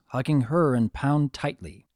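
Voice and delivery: ordinary delivery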